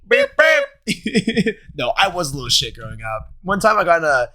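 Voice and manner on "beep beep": high imitated trumpet toots; put-on high beeping; mock trumpet beeps. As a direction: toot along like a tiny trumpet.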